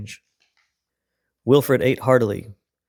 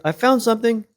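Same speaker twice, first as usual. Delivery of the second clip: high, eager call; bursting to share a find; excited shout; 10 semitones higher, 3 dB louder than usual. A narrator's reading. Wilfred calls down about his find, high and eager. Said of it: "shouted Wilfred".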